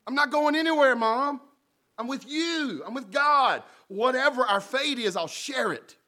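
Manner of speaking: high, loud heartfelt vow; Ruth's pledge. Acted. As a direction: promise her mother you'll stay. Ruth pledging to Naomi.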